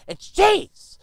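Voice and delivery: loud, high outrage on cheese; indignant. Shown as a demonstration. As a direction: spit out cheese in disgust. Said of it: "What do we get?".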